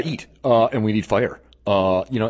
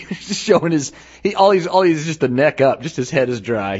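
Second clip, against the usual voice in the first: normal talking voice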